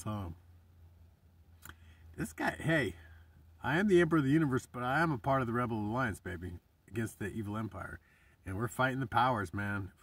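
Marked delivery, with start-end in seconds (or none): none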